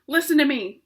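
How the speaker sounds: loud, urgent cry; cried out. Acted. Pig crying out to the rat for help. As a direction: call out desperately. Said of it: "cried Wilbur".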